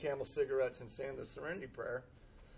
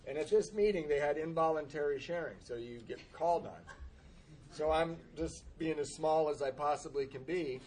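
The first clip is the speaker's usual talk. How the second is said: ordinary talk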